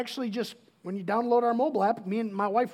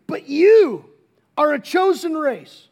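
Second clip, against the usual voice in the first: proclaimed scripture; 3 semitones higher, 10 dB louder, slower than usual. Acted. Reading scripture emphatically.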